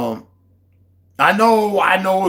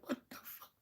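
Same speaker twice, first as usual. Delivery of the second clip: whispered; 24 dB quieter than usual. Retold or imitated. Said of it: "I was like".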